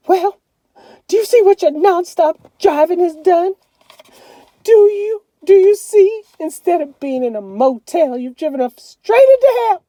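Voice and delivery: high, loud, shrill-pitched scolding; furious mother. A narrator's reading. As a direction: scold your husband at full fury. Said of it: "Mama was real hot".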